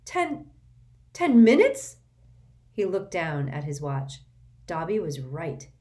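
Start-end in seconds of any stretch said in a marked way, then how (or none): 0.1-2.0 much louder, higher panicked repeat; realising he's late, alarmed; alarmed disbelief. A narrator's reading. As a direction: shout it as the panic hits. Shock at the time.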